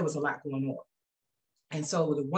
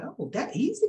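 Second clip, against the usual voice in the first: surprised; 9 semitones higher than usual. Retold or imitated. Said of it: "I was like".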